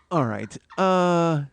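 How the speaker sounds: normal talking voice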